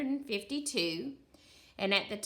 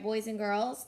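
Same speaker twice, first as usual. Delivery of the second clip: ordinary talk, nothing marked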